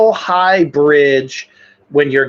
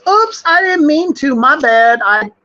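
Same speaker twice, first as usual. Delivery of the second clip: mock oblivious driver; 5 semitones higher than usual. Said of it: "somebody goes".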